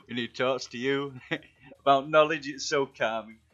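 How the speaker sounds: loud, amused, laugh breaking in; talking through laughter. Real, not acted. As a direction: praise a friend while cracking up. Cracking up while praising friend's voice.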